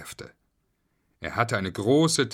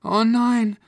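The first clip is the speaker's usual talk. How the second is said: put-on high, loud alarmed girl; squeaky, urgent kid's voice; Julia; 13 semitones higher, 8 dB louder than usual. A narrator's reading. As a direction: cry out like a child in trouble. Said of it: "beschloss Julia".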